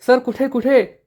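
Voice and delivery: high panicky questions; scared, searching for the cockroach; frightened. A narrator's reading. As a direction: pitch jumps up in fright. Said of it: "ईश्वरी खूप घाबरते".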